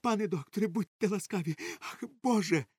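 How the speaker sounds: soft, high, frightened whisper; hushed and panicky; terrified whisper. A narrator's reading. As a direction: whisper a horrified plea. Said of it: "все з жахом шептала".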